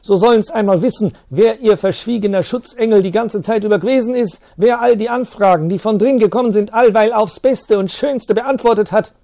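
high, loud spiteful defiance; indignant shouting. Acted. Teacher shouting defiantly at the priest. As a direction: throw his secret service in the priest's face.

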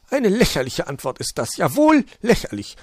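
high magpie voice, offended and loud; indignant magpie. Acted. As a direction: storm off in a huff. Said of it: "beschwerte sich die Elster".

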